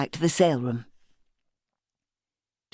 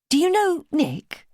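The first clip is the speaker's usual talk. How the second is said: put-on higher, slower young woman's voice; Simmy's voice; 12 semitones higher, slower than usual. Simmy asking Beverly about Nick, slowly and higher. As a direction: ask it carefully, pitched up.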